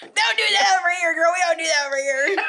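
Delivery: high, shouting and laughing; half-crying, laughing protest; freaked out. Real, not acted. Friends reacting to a sudden fright.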